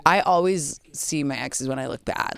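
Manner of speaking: ordinary talk, nothing marked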